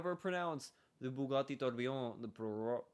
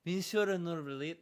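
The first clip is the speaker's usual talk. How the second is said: Monsieur Neuvillette with a louder French accent; 5 semitones higher, 5 dB louder than usual. Shown as a demonstration. Self-correcting, says Monsieur Neuvillette in French accent. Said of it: "No, Mons".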